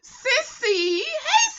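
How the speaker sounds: high, loud sing-song greeting; calling out. Real, not acted. Greeting a viewer named Sissy, loud and high. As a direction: call her name across the room.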